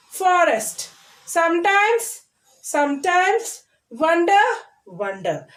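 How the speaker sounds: each word twice, first lifted then settling; repeating word drill. Shown as a demonstration. Teacher repeating each word for children to follow, paired drill. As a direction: pronounce every word in pairs, with pauses. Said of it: "Once again, I am repeating".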